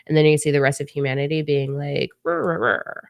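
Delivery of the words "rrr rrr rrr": growling like a grump; grumpy growling. Retold or imitated. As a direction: grumble rrr like a sulky kid. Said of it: "just grumpy pants all over".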